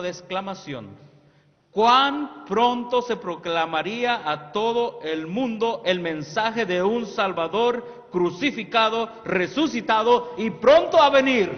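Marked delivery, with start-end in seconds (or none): 1.7-11.5 loud, slow, ringing pulpit proclamation; preaching. Acted. Preacher proclaiming a prophetic quote to the youth, loudly. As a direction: proclaim it to the whole church.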